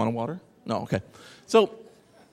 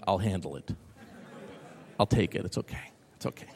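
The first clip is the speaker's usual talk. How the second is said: soothing reassurance; 6 semitones lower, 8 dB quieter than usual. Retold or imitated. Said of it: "I'm like".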